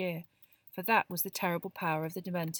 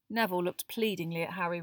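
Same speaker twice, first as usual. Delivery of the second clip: ordinary delivery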